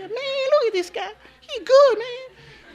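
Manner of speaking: slow, high falsetto coworker impression; mimicked coworkers. Coworkers admiring the fast new worker, slow mimicked falsetto. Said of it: "Ustedes saben cómo van ... los morenitos".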